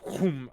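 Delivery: door slam whoosh. Acted. Marcus's magic slams the doors, loud imitated whoom. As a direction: imitate doors slamming shut.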